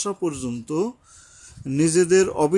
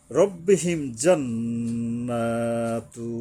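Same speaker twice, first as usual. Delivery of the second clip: very slow chanted Arabic recitation; slow Quranic recitation; 4 semitones lower, much slower than usual. Shown as a demonstration. Teacher recites the verse for students, slow chant. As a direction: draw each sound out in tajweed.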